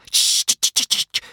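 urgent repeated shh; whispered shushing. A narrator's reading. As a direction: hush someone frantically. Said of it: "whispered old Wrinkly".

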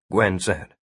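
plain reading voice

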